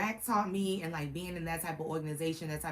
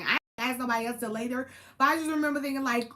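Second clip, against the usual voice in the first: ordinary talk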